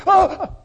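loud, high mock gasp; startled dog gasp. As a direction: gasp like a dog caught misbehaving. Imitates dog seeing rolled newspaper.

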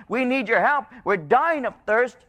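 high, pleading preacher's voice; crying out in need. Acted. Drought-stricken people beg God, crying out. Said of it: "they're crying out and they're saying".

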